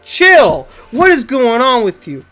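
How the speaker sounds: normal talking voice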